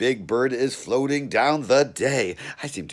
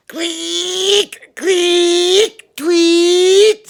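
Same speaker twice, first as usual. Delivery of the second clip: very high, loud whistled bird call; bird chirps; 12 dB louder, much slower than usual. Acted. The bird chirping in the park, shrill high bird call. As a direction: chirp like a songbird. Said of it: "Make bird songs".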